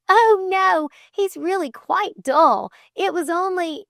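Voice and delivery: put-on high, loud, eager girl's denial; protesting. A narrator's reading. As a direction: deny it emphatically in a high voice. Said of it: "protested Flame".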